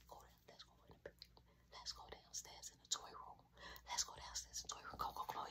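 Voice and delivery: hushed, hurried whisper; urgent whisper. Retold or imitated. Hurrying brother downstairs at night, urgent whisper. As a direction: whisper him along, hurry.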